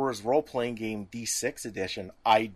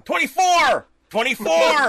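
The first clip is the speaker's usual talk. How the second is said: very loud, high holler; yelling; 11 dB louder, slower than usual. Retold or imitated. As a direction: belt it out as a punchline chant. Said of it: "starts yelling".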